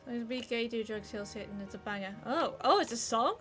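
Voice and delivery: ordinary talk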